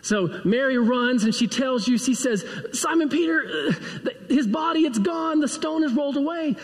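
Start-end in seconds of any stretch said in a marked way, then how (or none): 2.7-6.5 high, breathless mock panic; acted alarm. Mary runs to tell Simon Peter the body is gone, high panicked retelling. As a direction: blurt it out in a high, frantic voice, acting distraught.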